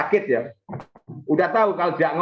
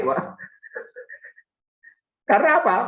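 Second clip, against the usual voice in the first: usual speaking voice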